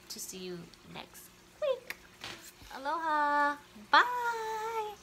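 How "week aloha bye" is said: much higher squeaky goodbye, louder; cutesy sign-off. Acted. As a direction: chirp the farewell way up high. Vlog sign-off to viewers.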